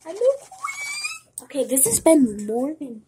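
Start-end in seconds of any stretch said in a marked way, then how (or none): 0.1-1.4 very high, loud, drawn-out squeal. Real, not acted. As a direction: stretch it into a piercing high squeal. Repeats it in a squeal.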